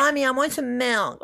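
put-on high little-kid whine; cutesy baby voice. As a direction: whine like a toddler asking mom. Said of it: "talk in a baby voice".

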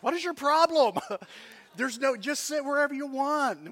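loud, high-pitched mock outrage; indignant crowd. Box-seat crowd to unknown lady, loudly indignant.

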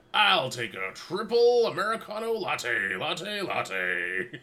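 high, loud rally-ad voice; monster truck announcer. Acted. Announcer ordering at Starbucks, hammed-up hype voice. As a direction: order coffee like an arena announcer. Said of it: "the guy who does those ads".

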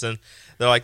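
ordinary talk, nothing marked

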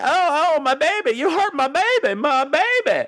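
high-pitched mock wailing mother; distressed mother. Voices a woman's distressed outcry.

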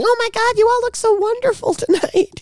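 high-pitched gushy imitation; mock gushing Jill Biden. Mimicking Jill Biden greeting the Grammys crowd, high mock gushing. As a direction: put on a high, sing-out gush. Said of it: "coming out and saying".

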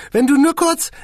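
high and self-satisfied; smug squeaky boss. A narrator's reading. Boss boasts to the musicians, high and smug. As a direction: brag a little in a tiny voice.

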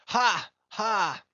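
loud, high scornful ha; defiant laugh. A narrator's reading.

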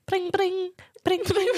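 high, sparkling bling sounds; sparkly princess. Shown as a demonstration. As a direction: chime it like a princess. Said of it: "Pretend like".

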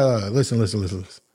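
low, hushed "listen, listen" from the boss; boss calming him. Retold or imitated. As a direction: drop the voice low to settle him down. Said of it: "He's like".

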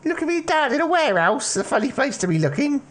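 put-on a jittery teen hiding in a warehouse; nervous teenage lad. GM voices teenage lad Jimmy.

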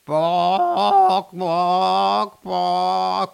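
loud clucking bark sounds; chicken imitation. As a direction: squawk it out like a chicken. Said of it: "imitating the chicken".

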